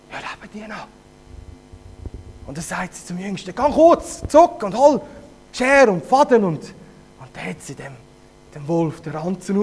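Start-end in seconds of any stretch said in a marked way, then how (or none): 3.5-6.4 urgent mother. Acted. Mother sends youngest for scissors.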